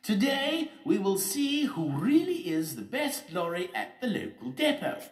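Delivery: very high, loud public speech; mayor's announcement. Acted. Mayor addresses the crowd.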